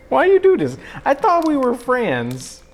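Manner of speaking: high, slow hammed-up hurt; mock betrayal. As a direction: whine like a betrayed friend.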